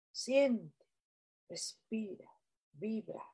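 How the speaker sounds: soft, hushed breath guidance; guided breathing. Acted. Leading her viewers through a breath, soft and hushed. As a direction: ease them into the breath, very softly.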